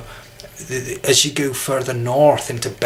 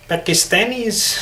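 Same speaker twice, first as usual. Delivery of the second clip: higher, nasal Pakistani attempt; Pakistani accent; 8 semitones higher than usual. Shown as a demonstration. Trying to contrast Pakistani with Indian, nasal Pakistani accent. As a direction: try a more nasal Pakistani sound. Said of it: "Pakistani is sometimes a bit more nasal".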